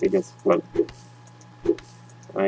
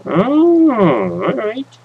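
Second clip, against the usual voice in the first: surprised; 5 dB louder, much slower than usual. Real, not acted. Discovers new crafting menu.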